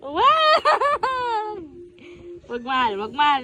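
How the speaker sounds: high, laughing plea to stop; laughing protest. Real, not acted. Mother pleading with tickling baby through laughter. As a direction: giggle while begging him to stop.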